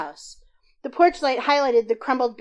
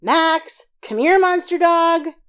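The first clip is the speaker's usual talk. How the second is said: much higher and louder, calling across the yard; calling out to dog; 10 semitones higher, 11 dB louder, slower than usual. A narrator's reading. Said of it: "I yelled".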